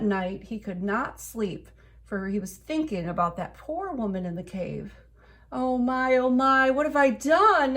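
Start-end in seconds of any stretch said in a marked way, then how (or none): 5.5-7.8 high, loud remorseful wail. A narrator's reading. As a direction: wail in guilt. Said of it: "he wailed".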